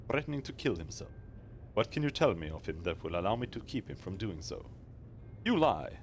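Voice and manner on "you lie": high, loud indignant cry; angry accusation. Acted. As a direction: throw back the accusation. Daughter accuses player.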